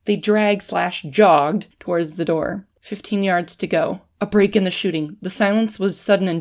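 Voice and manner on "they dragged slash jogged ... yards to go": usual speaking voice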